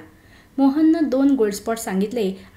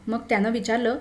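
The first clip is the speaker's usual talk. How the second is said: ordinary delivery, nothing marked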